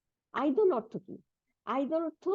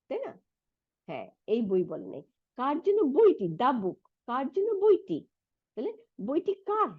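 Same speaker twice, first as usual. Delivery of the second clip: normal talking voice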